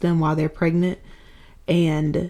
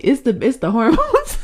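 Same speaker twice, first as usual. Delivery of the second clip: laughing; 6 semitones higher than usual. Real, not acted. Laughing while tearful.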